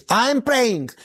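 high, defensive comeback, retold; indignant protest. Husband answering wife who says he doesn't pray, indignant.

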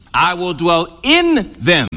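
slow down and hold "in" before "them"; stress on "in". Real, not acted. Preacher answering his question to the class, long stressed "in".

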